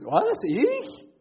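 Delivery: high, loud outraged "what, me?"; indignant. Retold or imitated. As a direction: bristle with offended disbelief. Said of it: "Der sagt mich ganz entrüstet".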